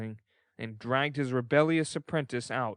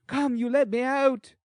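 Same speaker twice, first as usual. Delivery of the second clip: put-on higher, louder defiant boy's voice; 12 semitones higher, 6 dB louder than usual. A narrator's reading. Boy shouting from the cellar, higher defiant boy's voice. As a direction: demand to be let out.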